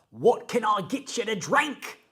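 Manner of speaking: mock Australian server accent; imitating a hammy Australian accent. Imitating the Outback server's fake Australian accent. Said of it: "speak in an Australian accent".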